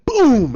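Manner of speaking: high, loud "boom"; imitated smash boom. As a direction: explode on the word like an impact. Imitates the rock being crushed.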